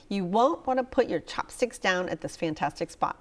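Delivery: ordinary talk, nothing marked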